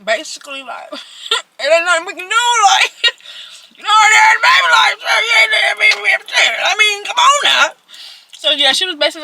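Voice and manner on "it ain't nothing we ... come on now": loud, high, hyped-up reassurance; high excited shout. Retold or imitated. Mom loudly reassuring her crying daughter, high excited shout.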